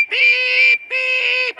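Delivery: very high, loud train whistle beeps; shrill out two panicked whistle toots; horrified whistle. A narrator's reading. Percy whistles at the oncoming express, horrified whistle. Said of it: "he whistled in horror".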